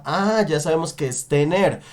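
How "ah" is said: ordinary talk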